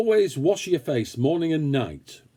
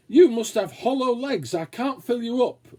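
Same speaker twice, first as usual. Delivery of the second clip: mother's voice; 9 semitones higher than usual. Acted. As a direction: tease him like a mother who can't fill him up. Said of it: "a favourite phrase of his mother's".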